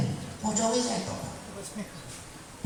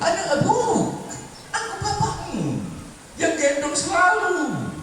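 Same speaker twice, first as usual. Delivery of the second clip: high pleading father voice; plead, arms open, stretching words; pleading ghost father; 14 semitones higher than usual. Retold or imitated. Dead father begs his son not to run, pleading.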